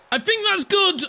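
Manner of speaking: the ball looks in, get excited; rising excitement as the shot goes in. Real, not acted.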